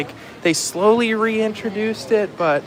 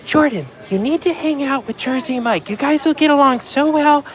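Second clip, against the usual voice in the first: imitating Jennifer; 8 semitones higher than usual. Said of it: "Jennifer keeps saying".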